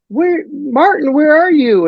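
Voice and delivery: high, slow, searching call for Martin; frantic woman calling. Retold or imitated. Retelling the film's ending, high anxious woman calling. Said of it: "the love interest woman is like".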